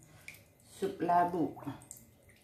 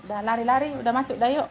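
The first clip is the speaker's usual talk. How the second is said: ordinary delivery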